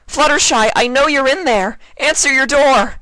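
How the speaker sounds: high, loud hollering at the door. A narrator's reading. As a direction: holler so she hears through the door. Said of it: "Twilight hollered".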